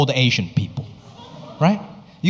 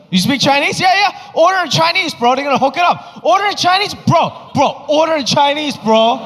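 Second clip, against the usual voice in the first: hyped bro; 9 semitones higher than usual. Retold or imitated. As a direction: egg him on like a pushy friend. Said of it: "They're like".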